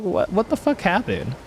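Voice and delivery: high-pitched, bewildered mock outburst; retold confusion. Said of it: "and I was so confused".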